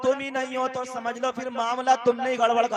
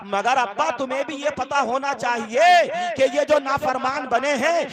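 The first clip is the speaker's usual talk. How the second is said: raised, ringing sermon voice; impassioned preaching; 4 semitones higher, 6 dB louder than usual. Acted. Preacher telling fathers they share the blame, impassioned preaching. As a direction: drive the rebuke home from the pulpit.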